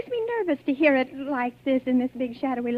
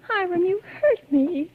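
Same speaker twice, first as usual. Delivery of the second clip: hurt reproach; 6 semitones higher, slower than usual. Acted. After he grabs her arm.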